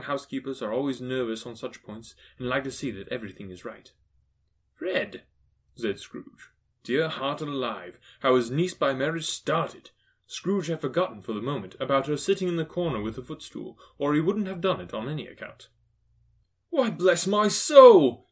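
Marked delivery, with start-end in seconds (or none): 4.7-5.2 high-pitched single-word call; calling to nephew. A narrator's reading. Scrooge calls to his nephew. 16.7-18.3 loud, high, drawn-out exclamation; astonished cry. A narrator's reading. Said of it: "cried Fred".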